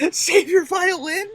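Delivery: laughing through the question; giggling as you read it. Real, not acted. Laughing while reading option.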